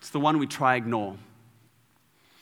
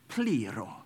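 softer, slow Greek accent; put-on Greek accent; 4 semitones lower, 5 dB quieter than usual. Shown as a demonstration. Speaker showing how Greeks would say it, put-on Greek accent. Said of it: "wanting to put on a Greek accent".

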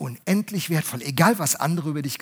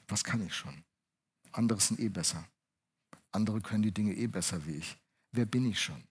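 much lower and softer, dejected; despairing teen thoughts; 7 semitones lower, 11 dB quieter than usual. Retold or imitated. Teenage preacher doubts his worth, quiet and dejected. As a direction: voice the boy's hopelessness quietly. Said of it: "ich dachte".

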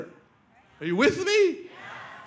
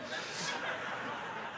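breathless laugh; 14 dB quieter than usual. Real, not acted. Laughs at his Peter imitation.

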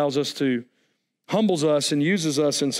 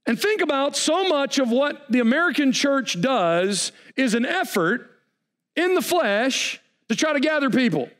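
fiery preaching; 6 semitones higher than usual. Acted. Preaching at full force.

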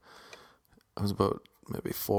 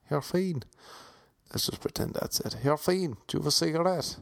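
higher, put-on Irish Traveller accent; mock Traveller accent asking for a cigarette; pikey accent; 6 semitones higher, 3 dB quieter than usual. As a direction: play a young Irish Traveller lad. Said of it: "No, that's not the pikey accent".

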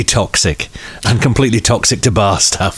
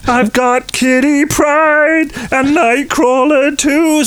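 put-on whiny kid; much slower than usual. Imitating his own childish wish.

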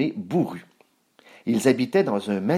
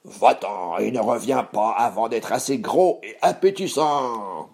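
shouting troll. Acted. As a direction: order it off as a hungry monster. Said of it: "Il cria".

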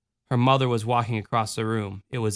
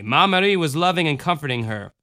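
ordinary delivery, nothing marked

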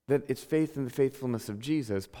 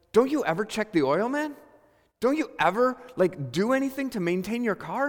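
higher, louder mock mechanic's telling-off; imitated raised-voice scolding; 8 semitones higher, 3 dB louder than usual. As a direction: imitate an exasperated mechanic laying into a customer. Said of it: "and his voice is raised".

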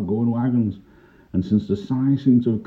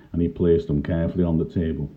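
usual speaking voice

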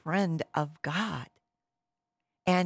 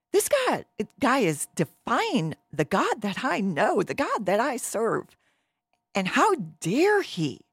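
higher, emphatic acted outrage; indignant; 6 semitones higher, 4 dB louder than usual. David answering his brothers about the giant, acted indignation. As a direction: protest as if personally affronted.

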